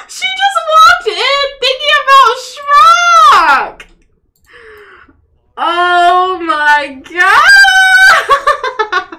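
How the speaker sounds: high, loud gleeful squeal; excited shriek. Real, not acted. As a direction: shriek with delight. Excited shipping of game characters.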